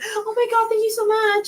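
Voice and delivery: high, laughing mock gush; gushing sister. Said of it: "Does she really talk like that?".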